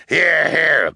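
high, loud assent; townsman's cry. A narrator's reading. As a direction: cry out agreement heartily. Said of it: "Mister Lesko cried".

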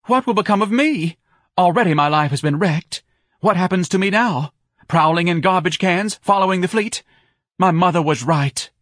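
high, loud self-pitying wail; distraught wife. A narrator's reading. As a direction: lament your wrecked life melodramatically. Wife lamenting, lids trembling.

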